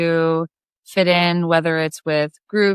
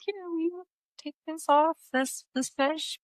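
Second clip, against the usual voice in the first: put-on much higher and softer, little-girl asking; mock girly plea; 10 semitones higher, 11 dB quieter than usual. Asking her big cousin to unhook the fish, a high soft plea. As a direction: squeak it out like a squeamish kid.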